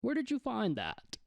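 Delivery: put-on high-pitched old man calling out; hollering old man. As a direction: call after someone in a high old voice. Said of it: "he hollers after you".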